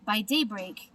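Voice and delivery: plain reading voice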